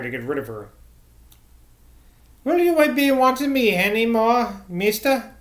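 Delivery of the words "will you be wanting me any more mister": put-on higher-pitched rustic old woman. A narrator's reading. Old church cleaner asking to be dismissed, high-voiced. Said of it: "the old woman responded".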